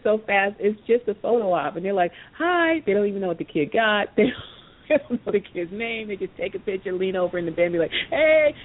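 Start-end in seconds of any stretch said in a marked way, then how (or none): 2.4-2.8 a high, hollow, sing-song "Hi"; mock cheery greeting. Athlete greeting a sick kid for the camera, hollow cheery hi. 8.1-8.5 a high, bright, hollow "Hey"; mock cheery greeting. Athlete leaning over a hospital bed, hollow cheery hey.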